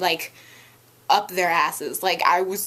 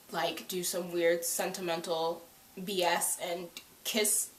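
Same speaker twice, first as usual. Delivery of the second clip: usual speaking voice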